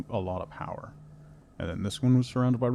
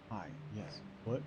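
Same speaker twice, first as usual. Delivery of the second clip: ordinary talk, nothing marked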